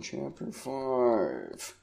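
soft, slow mumble to himself; muttered aside. Real, not acted. Host looking up a name, muttering softly to himself. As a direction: mutter it under your breath, slowly.

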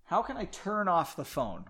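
plain reading voice

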